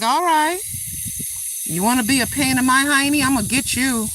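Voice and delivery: high, loud mock threat; hammed-up warning to a pest; mock threat to a wasp. Granny retelling what she tells the wasp, mock threatening yell. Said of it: "I'm like".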